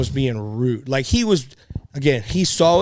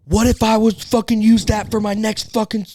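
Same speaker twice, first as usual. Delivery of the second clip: retold screaming; 8 semitones higher than usual. Gym guy yelling at him over a plate, mock shout. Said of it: "dude screaming".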